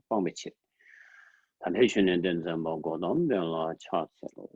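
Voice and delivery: low, measured chanting recitation; prayer recitation. Acted. Teacher opens the session with refuge verses, low prayer recitation.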